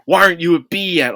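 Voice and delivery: a game nagging the player, hammed up; impatient nag.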